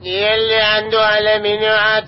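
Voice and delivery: slow, drawn-out street vendor cry; vendor's call. Acted. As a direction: stretch each word like a hawker calling down the street. (نداء بائع متجول بطيء وممدود)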